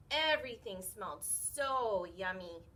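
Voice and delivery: usual speaking voice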